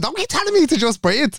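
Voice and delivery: high-pitched mock protest; retold indignation. Retold argument in bed.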